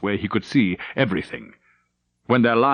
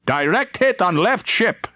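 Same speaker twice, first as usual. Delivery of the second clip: slow, high triumphant call; cheering; 9 semitones higher than usual. Acted. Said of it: "someone cheered".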